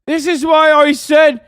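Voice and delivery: high, loud hammed-up angry dad yelling at Brian; shouting at Brian over reading the Bible; mock dad shout. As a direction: bellow like a furious Irish dad scolding his son. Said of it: "What's up with the dad's accent?".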